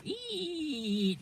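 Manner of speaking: long, high, soft eee creak; coffin creak. Retold or imitated. Host describing the coffin opening at night, imitated creak. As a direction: squeal like a coffin lid slowly opening.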